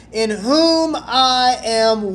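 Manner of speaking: stretched out slowly, held up; the preacher slowing to savor the verse; slow drawn out. Acted. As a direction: drag it out to build suspense.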